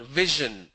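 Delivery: vision as VI-zhun; zh example. Shown as a demonstration. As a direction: buzz the zh in vision. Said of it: "It's a zh sound".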